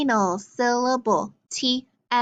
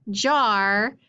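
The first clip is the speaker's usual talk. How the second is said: ordinary talk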